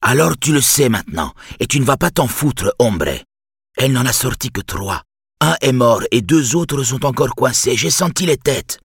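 lightly voiced insistent old neighbour; old man voice. A narrator's reading. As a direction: press him like a stubborn old man. Old Spaniard Lucio insists.

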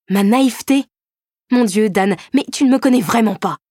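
outraged. Acted. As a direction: hurl it back, stung and furious. Heroine outraged at insult.

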